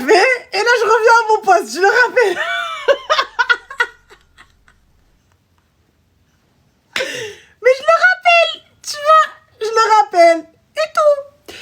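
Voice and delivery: high, squealing through real laughter; cracking up. Real, not acted. Storyteller calls the client back after coffee, laughing hard. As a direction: barely get the words out for laughing.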